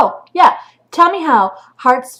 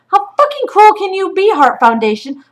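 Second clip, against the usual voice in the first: much higher and louder, an outraged demand; furious outburst; 11 semitones higher, 5 dB louder than usual. Real, not acted. Mother confronts the shelter over her son's heartbreak, furious. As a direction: yell the question right at them.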